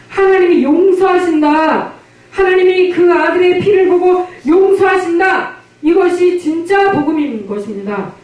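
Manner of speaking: climbing to a full-throated shout of forgiveness; fervent preaching. Acted. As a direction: proclaim it to the whole congregation. Preaches the gospel at full force.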